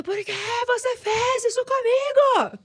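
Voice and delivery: high, slow anguished cry; a high wailing plea to heaven; crying out to God. Retold or imitated. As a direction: cry it up to the sky in despair. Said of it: "Gritar, olhando pro céu".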